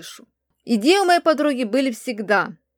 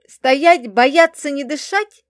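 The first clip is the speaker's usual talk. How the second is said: loud, raised battle cry; yelling commands; 4 semitones higher, 8 dB louder, slower than usual. A narrator's reading. Narrator imagines two novices ordering warriors about, yelling. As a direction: yell it out as a string of orders. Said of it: "вопящие что-то вроде".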